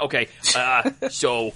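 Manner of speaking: ordinary talk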